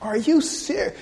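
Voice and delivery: incredulous. Acted. Preacher reacts to quitters going back to Egypt, incredulous.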